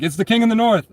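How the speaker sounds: high, loud hype shout of the nickname; hyped-up crowd call. Retold or imitated. People on the street hailing him, excited call. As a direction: call it out like fans greeting a hero. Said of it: "they're like".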